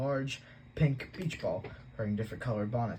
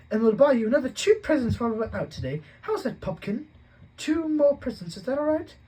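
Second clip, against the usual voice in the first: Aunt Petunia placating; 14 semitones higher, 9 dB louder than usual. A narrator's reading. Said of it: "she said quickly".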